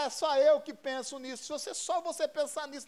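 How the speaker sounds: normal talking voice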